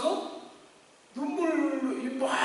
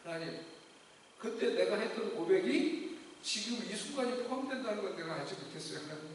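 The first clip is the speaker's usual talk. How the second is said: tearful prayer; 2 semitones lower, 7 dB quieter than usual. Retold or imitated. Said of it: "울면서 고백했는데".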